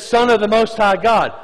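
loud, high proclamation; demon crying out. Acted. Demon naming Jesus in the retelling, loud and high. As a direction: shout the title out. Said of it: "the demon recognizes Jesus and says".

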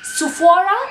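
a frustrated last stab at "Sephora?"; "Sephora?" nearly called out in giving up; exasperated Sephora. Real, not acted. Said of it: "my accent just".

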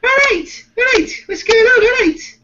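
Liverpool accent. Shown as a demonstration. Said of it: "And then if you're from Liverpool, it's like".

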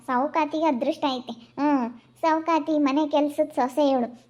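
ordinary talk

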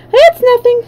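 high, dismissive character voice; high, proud shrug-off; breezy dismissal. A narrator's reading. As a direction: brush it off in a high-pitched voice. Battered hen brushes off her injuries.

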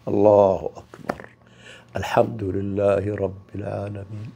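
low, slow prayerful recitation. Shown as a demonstration. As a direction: intone it slowly like in prayer. Demonstrates prayer opening. (تلاوة صلاة خاشعة بطيئة)

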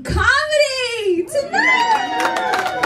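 high, drawn-out showy cry; triumphant announcement. Real, not acted. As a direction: belt it out, stretching the last word. Belting out a boast onstage.

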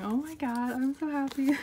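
overjoyed, ending near a laugh; happy. Real, not acted. Collector who got one of the biases, overjoyed.

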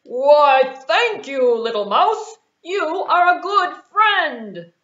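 loud, warm lion thanks; a big lion humbled; grateful lion. Acted. Freed lion thanking the mouse, loud and grateful.

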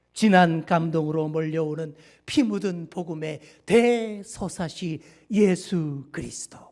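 booming dramatic trailer narration; old radio announcer. Pretend. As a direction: sell it like a vintage movie trailer. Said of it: "예고편 성우처럼 제가 한번 해보겠습니다".

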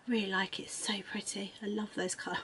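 soft whispered aside. Real, not acted. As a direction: breathe it to herself. Whispered aside about colours.

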